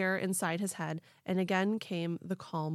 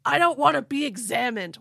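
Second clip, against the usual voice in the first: louder, higher yell of refusal; 5 semitones higher, 8 dB louder than usual. Retold or imitated. Ed refusing the aliens' tests, loud yell. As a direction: shout him off, higher. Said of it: "he yelled".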